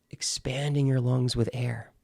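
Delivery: whispered on the breath; breathy whisper. Shown as a demonstration. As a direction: breathe the words. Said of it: "inhale deeply".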